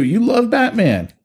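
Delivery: high-pitched excitable movie-character impression; hammed-up, high-pitched teasing; Rod Tidwell. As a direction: crow it high with stretched emphasis. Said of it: "said in my best Rod Tidwell impersonation".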